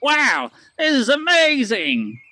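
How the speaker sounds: high, slow, wide-eyed wow; a stranger marveling at the boulder; amazed customer. Acted. Customer gasping in amazement. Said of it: "and the guy goes".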